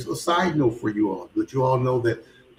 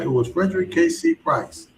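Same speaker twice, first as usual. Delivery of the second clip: usual speaking voice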